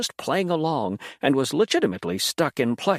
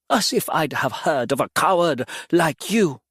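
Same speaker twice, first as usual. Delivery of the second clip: spat; 4 semitones higher, 3 dB louder than usual. A narrator's reading. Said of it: "Mattis spat".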